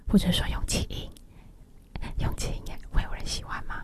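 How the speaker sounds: voiceless, airy ASMR whisper; all-breath whisper. Shown as a demonstration. Host trying out breath-only talk for radio listeners, whispered. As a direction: drop to pure breath, very softly.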